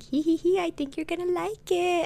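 high, soft mom voice with tittering; imitated giggly mom. Mom teases daughter about a snack, high giggly voice. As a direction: titter like a delighted mother.

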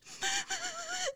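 very high, squeaky crying; hammed-up chick sobbing; baby-bird wail. A narrator's reading. Young wrens wail to their parents, high-pitched crying. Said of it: "continued to cry and scream".

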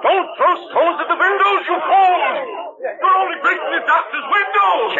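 furious shout at stone-throwers; angry shout. Acted. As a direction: bellow at the fools to stop. Yelling at mob throwing stones.

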